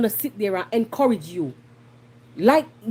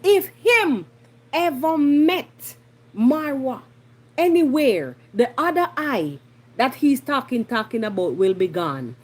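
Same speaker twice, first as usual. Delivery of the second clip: retold threat. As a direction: imitate a menacing warning, stretching the first words. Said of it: "full on threatening Marwa".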